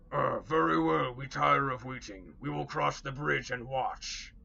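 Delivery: loud orc leader, slowing at the end; a hungry orc who is done waiting. Acted. Orc leader decides to cross.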